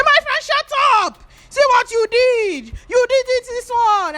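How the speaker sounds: very high, loud mock shout; mock shrill scolding. The boys shouting Ruth down, high and scornful. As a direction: shriek it down at her, no mercy.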